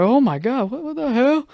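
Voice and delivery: high mock-shocked reaction; mock shocked dad. Dad reacting to his crying son, mock shocked. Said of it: "he was like".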